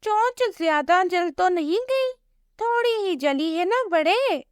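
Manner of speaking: lightly voiced caring parrot; parrot doctor. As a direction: check the patient kindly. Parrot doctor checks the chick.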